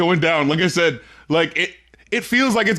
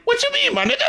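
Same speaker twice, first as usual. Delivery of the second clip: much higher, squeaky voice for the little son; son's voice; faster than usual. Retold or imitated. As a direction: play the kid, eager and piping. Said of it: "And it's like".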